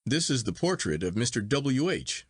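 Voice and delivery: ordinary delivery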